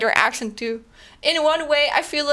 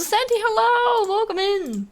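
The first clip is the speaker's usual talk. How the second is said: cheery greeting; 11 semitones higher, slower than usual. Real, not acted. Greeting a viewer in chat.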